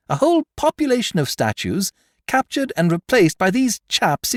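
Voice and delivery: very high, loud, eager showing; exultant explanation. A narrator's reading. Beautrelet showing the Count the fake plaster, exultant. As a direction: thrill at exposing the theft. Gloating to the magistrate.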